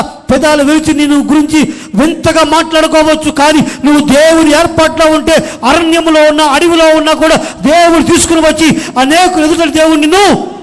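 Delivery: fiery sermon peak; preaching at full force. Acted. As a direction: proclaim it to the whole congregation. Preacher climaxes a point.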